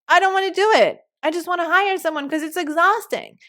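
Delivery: high-pitched mock complaint; whiny refusal. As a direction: protest like you're fed up with chores. Acting out reluctant entrepreneurs' complaint.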